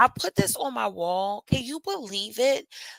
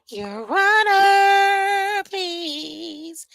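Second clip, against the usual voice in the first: high, very slow, dragged-out plea; mock plea to the judge; 11 semitones higher, 6 dB louder, much slower than usual. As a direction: beg the judge theatrically, stretching each word.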